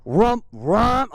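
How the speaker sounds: two throttle blips voiced as "rump"; imitated engine revs. Shown as a demonstration. Rider explaining when to drop the clutch, imitated revs. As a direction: mimic the motor revving twice. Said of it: "what I need to do is go".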